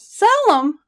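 high, loud outcry; startled cry. A narrator's reading. Partner hears his rifles might be sold, cries out. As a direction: cry it out in alarm. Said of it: "I cried".

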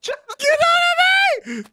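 very high, drawn-out scream; high-pitched frantic yell; exasperated shout. Real, not acted. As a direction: screech at a teammate to get off. Yelling at squirrel.

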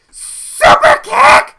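high, drawn-out yell; shouted move call. Real, not acted. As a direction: belt out the finishing move like a battle cry. Calls a wrestling move.

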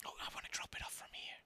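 fast, hushed whisper. Real, not acted. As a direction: whisper it quickly. Whispered aside.

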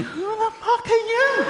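high, slow squeaky bewildered voice; a puzzled falsetto aside; mock inner thought.

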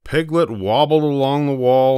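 usual speaking voice

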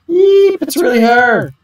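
high, excited fangirl squeal; starstruck fan squeal. Acted. Fan spotting her idol Risa, high excited squeal. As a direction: squeal with delight at seeing your idol.

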